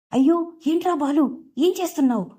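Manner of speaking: high, alarmed cry at her son; mother's startled shout. A narrator's reading. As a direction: call out sharply, pitched high in shock. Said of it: "అంటూ గట్టిగా అరుస్తుంది".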